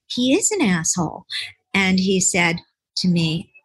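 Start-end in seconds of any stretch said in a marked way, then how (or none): 0.1-1.3 eager agreement. Retold or imitated. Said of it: "And so I said".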